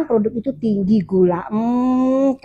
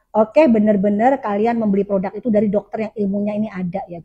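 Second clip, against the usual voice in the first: ordinary talk, nothing marked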